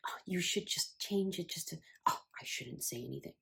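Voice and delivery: whispery mock grandmother nitpicking; hedged criticism. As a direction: half-whisper the advice, then take it back softly.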